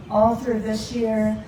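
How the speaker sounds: usual speaking voice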